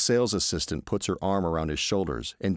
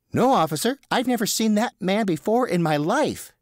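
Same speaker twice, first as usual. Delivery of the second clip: higher, overeager denial; flustered Teddy; 6 dB louder than usual. Acted. Teddy lying to the officer, higher flustered denial. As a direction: protest too much, nervously. Teddy denies knowing the man.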